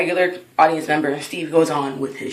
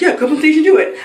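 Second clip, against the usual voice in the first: higher, louder egging-on; retold urging; 10 semitones higher, 6 dB louder, faster than usual. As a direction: imitate someone pushing a friend to go up. Retold comedian urging her onstage.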